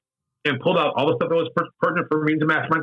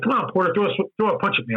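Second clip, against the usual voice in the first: higher-pitched goading, daring him to swing; taunting challenge; 6 semitones higher than usual. Retold or imitated. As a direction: egg him on to throw a punch. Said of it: "Brian would be like".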